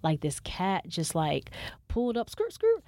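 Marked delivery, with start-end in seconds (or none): 2.3-2.8 high, loud mock tire screech. The cat pulls up, high loud skirt skirt. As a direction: screech like a car braking.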